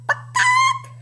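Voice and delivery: very high "ba-gawk"; piercingly high imitation hen cry; loud chicken cluck. A narrator's reading. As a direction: squawk it like a triumphant hen. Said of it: "clucked the loudest".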